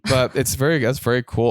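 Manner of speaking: ordinary talk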